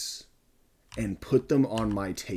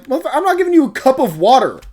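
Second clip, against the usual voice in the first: much higher, louder and faster angry shout; furious refusal; 11 dB louder, faster than usual. Retold or imitated. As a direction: blast the refusal right back at him.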